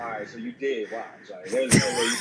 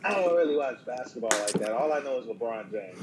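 normal talking voice

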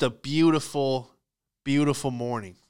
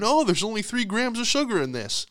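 high, airy musing to oneself; oblivious grocery shopper; 9 semitones higher than usual. Acted. Shopper reading a box in frozen foods, high idle musing. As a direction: play a shopper idly reading a label. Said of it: "looking at the back of a box".

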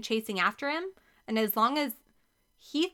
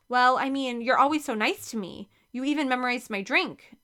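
ordinary delivery, nothing marked

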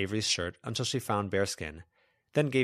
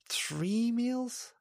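tiny, very high-pitched question; little opossum voice. A narrator's reading. Little opossum speaks after laughing.

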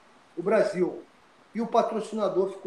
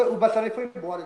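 ordinary talk, nothing marked

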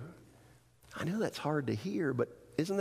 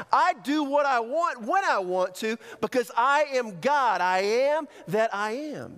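high, forceful preaching proclamation; fervent, emphatic divine declaration; God declaring himself; 7 semitones higher, 3 dB louder than usual. Acted. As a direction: declare absolute authority, leaning on key words. Preacher speaks as God to Moses.

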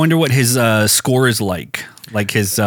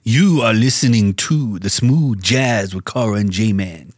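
slow smooth-jazz radio host; slower than usual. Pretend. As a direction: linger on each word like a jazz DJ. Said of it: "I'll do it in smooth voice".

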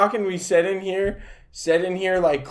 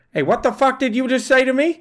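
mock Boston tough guy; 10 semitones higher, 4 dB louder than usual. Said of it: "We'll be like".

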